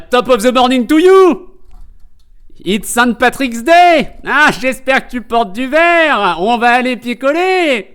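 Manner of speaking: loud, high, hearty Irish caricature; mock Irish accent. Streamer parodying grandpa's Irish accent for Saint Patrick's Day, mock Irish accent. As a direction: belt it out as a stage Irishman, stretching the endings. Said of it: "Il a fait un accent irlandais".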